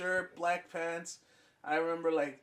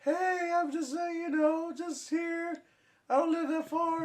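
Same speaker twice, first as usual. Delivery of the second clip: very high, sing-song woman's voice; a wheedling high voice, half sung; meth head voice; 12 semitones higher than usual. Retold or imitated. Retelling the stranger's pitch to friends, in a very high sing-song voice. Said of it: "the voice sounds like a meth head".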